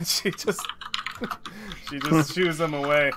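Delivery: usual speaking voice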